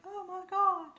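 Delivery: very high, startled oh my God; mock a reader's stunned reaction; shocked gasp.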